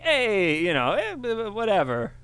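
bad Brooklyn accent, hammed up; a rough Brooklyn guy, fast; New York accent. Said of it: "like, New York accent".

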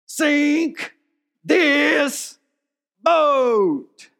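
high, loud defiant yell at the storm; Lieutenant Dan yelling. Retold or imitated. Lieutenant Dan on the mast defying God, shouting. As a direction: scream each word out into the hurricane. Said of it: "he's like".

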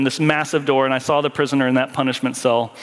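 usual speaking voice

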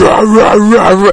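mock parent demon voice, loud roaring rah-rahs. Co-host mocking the mother's discipline, exaggerated demon voice. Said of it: "I've heard you do the demon voice".

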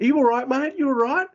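villain faking sympathy to his victim; mock concern. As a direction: coo it while twisting the knee. Despy taunting while hurting knee.

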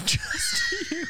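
high, slow laughing echo. Real, not acted. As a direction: laugh it out in disbelief. Laughing disbelief at talking cat.

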